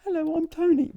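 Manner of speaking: high, tiny talking-key voice; Tony, little voice. Acted. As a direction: pipe up like a little gadget calling out its name. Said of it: "so that it's still going".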